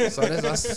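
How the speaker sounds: a real burst of high laughter; breaking up laughing mid-sentence. Real, not acted. Cracks up at own story.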